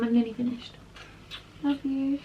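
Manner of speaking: soft, loving aside; real tender aside. Real, not acted. Vlogger reassuring baby she's nearly done filming, soft and tender. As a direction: say it gently, away from the audience. Private aside to loved one.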